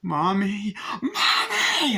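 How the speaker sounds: high cry rising to a shriek; terrified calling. Retold or imitated. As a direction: imitate a frightened child screaming for help. Acts terrified child calling mother.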